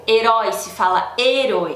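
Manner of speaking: normal talking voice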